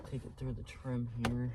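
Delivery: usual speaking voice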